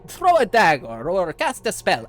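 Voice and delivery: loud, high call to everybody around; exasperated boredom. Acted. Bored pirate asking anyone to attack the mage, exasperated. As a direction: start restrained, then holler for volunteers. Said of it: "I'm officially bored".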